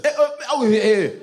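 high-pitched grunts of a father swinging; hammed-up angry effort grunts; wordless whooping grunts. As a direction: imitate dad's wordless beating noises. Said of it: "wouldn't even use full sentences when he whooped us".

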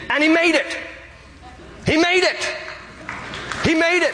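soaring high, triumphant and emphatic; rising exultant cry. Real, not acted. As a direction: ring out the good news, high and exultant. Preacher exults, he made it.

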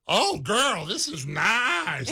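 cat admiring its new home in a sassy voice; Instagram cat voice. Retold or imitated. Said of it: "you've heard that Instagram".

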